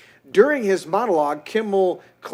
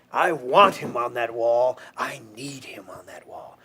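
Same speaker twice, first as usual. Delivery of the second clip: low, intense film quote, falling to near whisper; Jack Nicholson; 5 semitones lower than usual. Acted. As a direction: growl it low like a military commander. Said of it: "Jack Nicholson".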